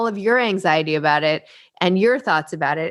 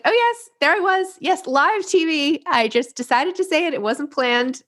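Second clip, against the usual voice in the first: high-pitched, giddy retelling; playful mock excitement; 9 semitones higher than usual. Host playing back the guest's live TV story, mock excited. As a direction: imitate someone gushing, pitched well up.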